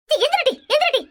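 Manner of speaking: high-pitched, impatient command; alarmed, insistent cry; frantic urging. Acted. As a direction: hurry the child up in a panic. Mother urging sick child up.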